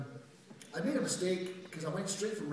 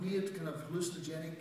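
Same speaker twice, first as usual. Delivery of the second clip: normal talking voice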